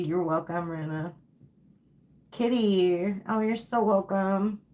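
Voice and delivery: usual speaking voice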